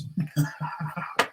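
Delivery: amused chuckle after a joke; real laugh. Real, not acted. Storyteller tickled by his own inner plea, laughing. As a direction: laugh at your own punchline.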